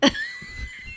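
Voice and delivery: high burst of laughter; a big shrieking laugh; laughing shout. Real, not acted. Friend laughing hard at the mom's salmon story, high laughing shout.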